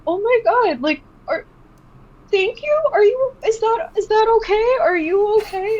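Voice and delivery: high, sobbing, flustered questions; crying along while asking if they're okay; imitating own crying. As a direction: artist tearing up as she hugs a crying fan. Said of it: "I'm gonna start crying now".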